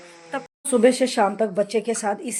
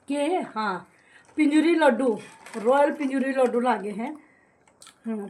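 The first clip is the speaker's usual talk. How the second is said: ordinary talk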